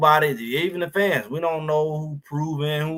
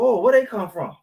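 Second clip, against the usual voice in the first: mock surprise; 8 semitones higher, 3 dB louder than usual. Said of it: "it'd be like".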